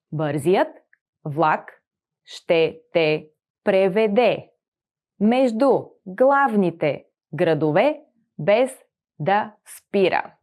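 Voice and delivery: Бързият, влак, ще те преведе, each part paused; slow dictation of Бързият влак; slow, split sentence with pauses; chunk by chunk. Shown as a demonstration. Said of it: "teacher splits sentence into chunks".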